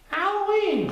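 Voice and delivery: put-on high, loud excited boy; excited Christopher Robin. A narrator's reading. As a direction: burst it out with glee. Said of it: "informed them excitedly".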